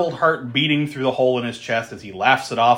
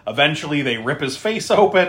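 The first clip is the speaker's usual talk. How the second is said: ordinary talk, nothing marked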